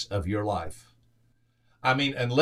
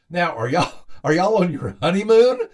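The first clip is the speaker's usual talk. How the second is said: higher and louder, eager rising question; curious wife; 7 semitones higher, 7 dB louder than usual. Retold or imitated. Wife asking elderly couple if newlyweds, eager rising question. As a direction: ask the old couple with bright surprise.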